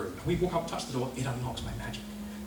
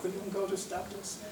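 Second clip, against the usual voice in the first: ordinary talk, nothing marked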